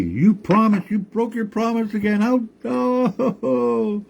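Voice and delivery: put-on high, distressed old woman scolding; grandma wailing at the tree over her ruined camp; upset grandma yelling. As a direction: cry out at the tree who broke his promise. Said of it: "she yelled at that tree".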